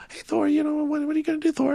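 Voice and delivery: high, put-on Polynesian bouncer voice; big rock guy, tough bouncer impression; Mike Tyson-y bouncer voice. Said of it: "That's my Mike Tyson".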